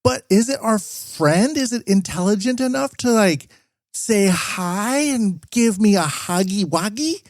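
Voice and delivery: high-pitched mocking whine of naive worry; exaggerated high voice of a clueless worrier; mock Western hand-wringer. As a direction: fret in a silly high voice, slowing down towards the end. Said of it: "That's what so many people in the West".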